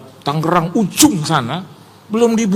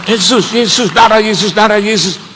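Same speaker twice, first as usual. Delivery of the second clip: loud, raised chant-like cries; mock frantic invocation; 3 semitones higher, 5 dB louder than usual. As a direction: imitate someone urgently invoking a holy name. Mengejek seruan yang menakuti orang. (meniru seruan panik dengan lantang)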